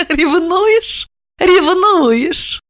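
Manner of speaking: laughing, high and mocking, rising at the end; teasing laugh. A narrator's reading. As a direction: tease him through a laugh. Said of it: "Она рассмеялась".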